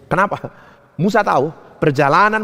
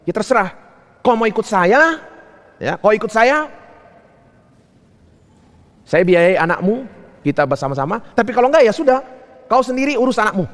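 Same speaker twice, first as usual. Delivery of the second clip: lightly acted husband, pitch climbing; acted husband's ultimatum; 7 semitones higher than usual. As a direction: lay down terms to a wife, rising in pitch. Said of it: "apa yang disampaikan kepada istrinya".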